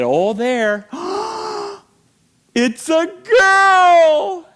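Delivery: high, loud squeal of delight; excited announcement. Retold or imitated. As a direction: gasp then shout the news. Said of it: "the technician said".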